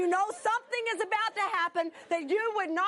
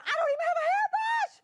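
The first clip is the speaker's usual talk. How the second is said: high-pitched, fast squawk; hammed-up frantic complaint; exasperated outburst; 13 semitones higher, 4 dB louder, faster than usual. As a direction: blurt it out loudly in protest. Acted outburst at God.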